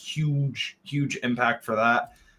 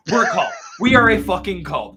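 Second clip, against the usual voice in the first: excited exclaiming; 8 semitones higher, 8 dB louder than usual. Real, not acted. Laughing cult joke.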